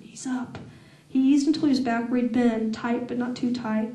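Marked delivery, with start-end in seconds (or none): 0.0-0.6 whisper. A narrator's reading. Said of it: "I whispered".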